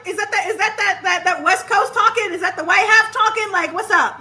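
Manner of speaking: high, incredulous needling; hammed-up indignant teasing; mocking disbelief. Acted mocking challenge of his talk.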